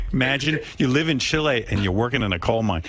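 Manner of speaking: normal talking voice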